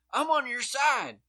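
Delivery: put-on cozy up to the officer like a drunk; mock drunk suspect voice; drunk guy on Cops. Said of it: "a drunk fucking guy on cops is like".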